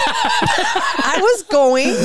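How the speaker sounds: stretched out through a laugh. Real, not acted. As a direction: laugh it out slowly. Laughing protest at interruption.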